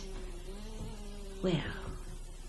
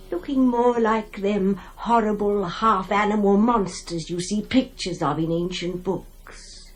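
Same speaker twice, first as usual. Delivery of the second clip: ordinary talk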